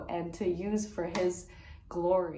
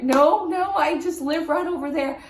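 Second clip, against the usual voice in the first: higher, louder, eager reassurance; 8 semitones higher, 7 dB louder than usual. Retold or imitated. Vlogger tells the woman she lives nearby, eager, higher and louder. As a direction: quickly set her straight, bright and keen.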